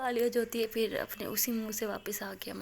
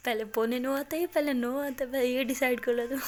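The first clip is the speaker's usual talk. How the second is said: louder mock-indignant quibble; raised-voice playful objection; teasing challenge; 3 semitones higher, 7 dB louder than usual. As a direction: challenge the count, loudly.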